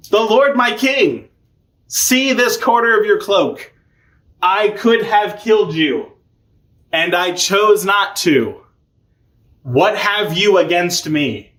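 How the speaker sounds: high, slow declamatory call; retold grand speech, drawn-out words; mock proclamation. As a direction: call it out slowly, like a formal appeal. David calls out to Saul.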